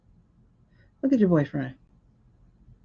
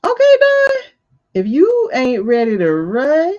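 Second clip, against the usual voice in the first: mock call-out; 4 semitones higher, 6 dB louder than usual. Mock address to Don.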